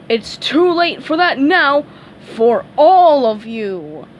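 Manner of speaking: loud, slow doom; menacing threat. A narrator's reading. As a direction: pronounce their doom slowly. Patrick menacing the crowd.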